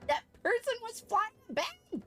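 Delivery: very high, disbelieving squeal; real incredulous amazement. Real, not acted. Streamer spots a player flying on a bed, incredulous squeal.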